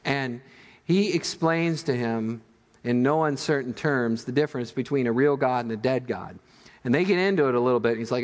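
normal talking voice

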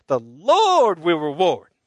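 loud, slow, stretched out revival cry; Southern revival shout. Shown as a demonstration. Pastor leading a Southern revival chant, loud and slow. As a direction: preach it like a tent meeting. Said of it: "This is like a Southern revival".

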